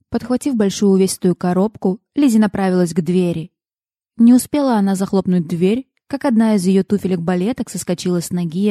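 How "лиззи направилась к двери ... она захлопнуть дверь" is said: ordinary delivery, nothing marked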